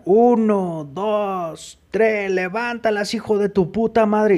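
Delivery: high, drawn-out counting turning into an insult; barking out the count like a drill sergeant; mock guard.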